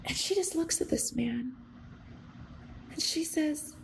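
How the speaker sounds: usual speaking voice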